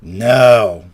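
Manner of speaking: imitating a half-asleep friend's loud refusal; very groggy. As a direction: drag it out as if barely awake. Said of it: "very groggy voice".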